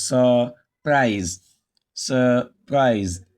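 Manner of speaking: "surprise" broken slowly into sur, prise; split into syllables. Shown as a demonstration. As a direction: say "surprise" as two separated syllables. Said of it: "it contains two syllables".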